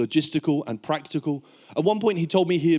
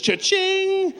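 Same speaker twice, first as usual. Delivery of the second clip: squeaky high cash-register call; high cha-ching. Retold or imitated. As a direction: sing it up high. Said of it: "think to yourself".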